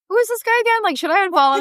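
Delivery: high, puzzled wondering; who even is this; squeaky high bewildered musing. Retold or imitated. Wondering about an old acquaintance.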